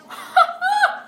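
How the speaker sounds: high-pitched, loud giggle; loud squeal of laughter. Real, not acted. Amused by the big meatball.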